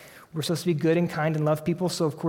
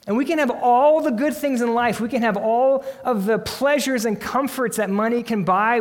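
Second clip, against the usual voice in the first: louder, higher, building preacher's appeal; rising preaching fervor; 5 semitones higher, 5 dB louder than usual. Real, not acted. Pastor pressing the congregation about emptiness, in a louder, higher preaching voice. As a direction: drive each "we can have" home to the congregation.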